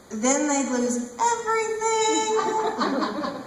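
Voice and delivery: high, stretched-out punchline; mounting climax. Acted. Performer mocking her flustered male lovers, climaxing. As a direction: draw the last word out high and slow.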